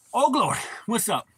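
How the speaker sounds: loud, high happy call to a dog; excited greeting. Real, not acted. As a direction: greet the pet with a burst of joy. Greets pet arriving.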